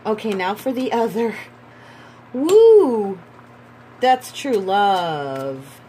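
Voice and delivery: real delighted cheer; excited whoop. Real, not acted. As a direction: cheer at the card. Reveals partner card, whoops.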